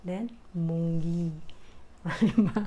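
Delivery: usual speaking voice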